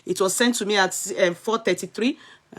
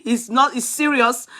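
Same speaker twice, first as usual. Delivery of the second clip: ordinary talk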